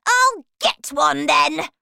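high, loud bratty outburst; defiant child's shout. Acted. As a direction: yell it as a spoilt threat. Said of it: "shouted Veruca".

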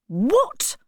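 a sharp, high, angry "What?"; startled angry baronet; angry cry. A narrator's reading. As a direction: throw it out in sudden anger. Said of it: "cried the baronet angrily".

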